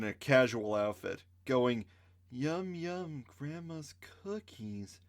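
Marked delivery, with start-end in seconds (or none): 2.3-5.0 eerie sleepwalker murmur. Acted. Sleepwalker woman chewing victim.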